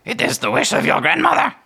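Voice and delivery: high, loud, solemn bird voice; raven decree. Acted. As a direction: announce the prophecy like a raven herald. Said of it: "it cocks its head again".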